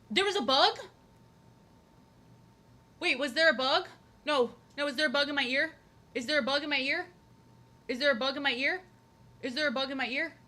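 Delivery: high, loud panicked questions; frantic, checking her ear; real panic. Real, not acted. Panics about a bug in her ear.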